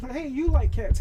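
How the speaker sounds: high and loud, stress on like; mock eager hello; excited greeting. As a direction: call it out, stressing like. Acting out cat-friend greeting.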